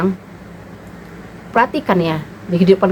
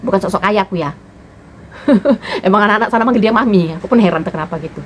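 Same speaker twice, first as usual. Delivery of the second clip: ordinary talk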